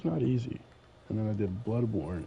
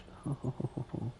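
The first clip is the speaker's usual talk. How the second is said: breathy relieved chuckle; relieved laughter; 4 semitones higher, 5 dB quieter than usual. Real, not acted. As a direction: laugh out the tension. Relief after beating section.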